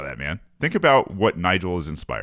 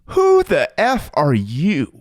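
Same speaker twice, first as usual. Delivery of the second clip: indignant; 8 semitones higher, 6 dB louder, much slower than usual. Real, not acted. Outraged at the speaker's arrogance.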